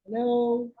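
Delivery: usual speaking voice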